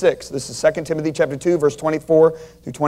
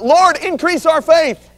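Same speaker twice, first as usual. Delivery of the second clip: crying out; 8 semitones higher, slower than usual. Acted. Disciples crying out to the Lord, desperate and high. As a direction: cry out to the Lord for more faith. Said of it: "the disciples cried out".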